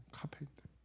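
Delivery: soft unvoiced whisper of 카페인 때문에, breath only; whispered echo. Shown as a demonstration. Pastor echoes his own phrase to the congregation in a whisper.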